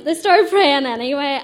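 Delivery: ordinary talk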